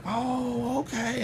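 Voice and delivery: high, soft, drawn-out reaction; mock-polite realization. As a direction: let it float up high and quiet. Sarcastic reaction to condescending principal.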